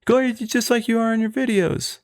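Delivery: lightly mocking high-pitched fan voice; mimicked fans. Friend quotes fans who recognize the other host, mimicking them in a high voice.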